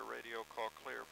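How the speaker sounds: ordinary talk, nothing marked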